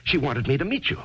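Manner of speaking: agitated. Acted. Agitated insistence.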